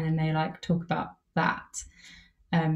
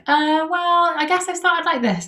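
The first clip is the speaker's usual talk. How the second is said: much higher, hesitant voice of a first-time interviewee; 11 semitones higher, 4 dB louder than usual. Retold or imitated. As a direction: play someone fumbling into an answer, pitched way up. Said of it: "they'll be like".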